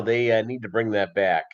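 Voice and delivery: usual speaking voice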